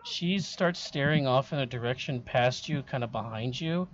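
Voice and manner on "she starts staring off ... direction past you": ordinary talk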